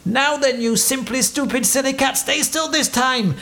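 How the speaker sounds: high-pitched, fed-up captain's scolding; mad. A narrator's reading. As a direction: tell the cat off, voice way up. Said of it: "He was mad".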